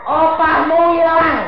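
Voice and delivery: high breathy laugh then slow loud proclaim; laughing preacher. Real, not acted.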